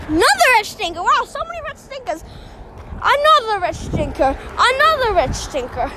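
sneering character line; acted play line. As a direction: spit out the scorn for the rich. Acting a line from his play.

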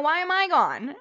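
loud, startled confusion; startled question. Real, not acted. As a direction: ask it in sudden alarm. Webcam fails, first startled take.

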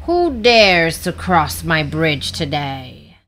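slow, menacing troll challenge; drawn-out troll voice. Acted. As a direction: challenge a trespasser as a storybook troll. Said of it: "Peep met a troll".

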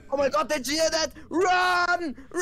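high, fast and loud; alarmed shout. Pretend. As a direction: yell in sudden panic. Sudden alarm, fleeing.

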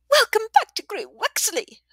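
high, loud, cackling tavern woman; boisterous old widow. A narrator's reading. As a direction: hail an old friend across the room. Widow voice continues.

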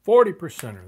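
loud, excited call-out; excited shout. Real, not acted. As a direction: shout it out like a big win. Big win on a pull.

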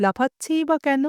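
ordinary delivery

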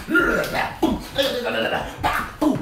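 high loud mouth gunfire and booms; gunfire noises. Retold or imitated. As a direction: blast out shot noises. Acting out the gunfight.

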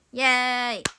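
loud, drawn-out "yay"; cheering. Acted. Streamer welcoming the can't-do corner, loud cheering. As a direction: give a big long cheer.

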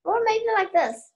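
very high, bird-like line; high parrot voice. Shown as a demonstration. Child trying out a parrot voice, very high. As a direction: pitch right up like a squawking parrot. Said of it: "Way too high".

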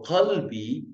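drawn-out Qalbi with a guttural Q; throaty Arabic Q. Shown as a demonstration. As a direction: begin Qalbi at the back of the throat. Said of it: "Vai ficar Qalbi".

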